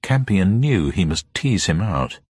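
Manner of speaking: plain reading voice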